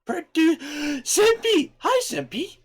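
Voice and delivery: very high excited greeting; high-pitched squeal. Real, not acted. Greeting a chatter cutely.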